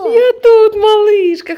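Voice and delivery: high, loud reassuring call. Real, not acted. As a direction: answer the little one brightly. Mother answers child.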